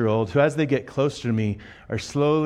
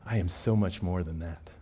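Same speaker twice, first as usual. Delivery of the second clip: softer, delighted mutter; 6 semitones lower, 7 dB quieter than usual. Acted. Jesus mutters under his breath, softer and delighted. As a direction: mutter it to yourself, pleased. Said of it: "muttering under his breath".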